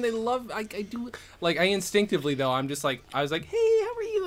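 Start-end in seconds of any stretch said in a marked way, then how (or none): 3.5-4.3 high, cutesy greeting voice; greeting little kids. Retold or imitated. Comic chatting about kids loving them, high cutesy greeting. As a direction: coo it high as if to a small child.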